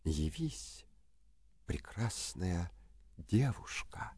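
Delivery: soft slow summoning whisper; whispered spell. A narrator's reading. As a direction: breathe the magic words softly. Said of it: "возьми этот башмачок и шепни".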